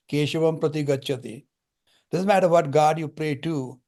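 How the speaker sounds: ordinary talk, nothing marked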